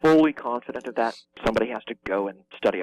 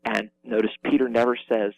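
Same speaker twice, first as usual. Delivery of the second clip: ordinary talk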